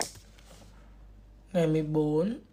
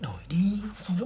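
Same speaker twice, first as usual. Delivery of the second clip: whisper; 5 dB quieter than usual. Acted. Sneaking seat swap in class.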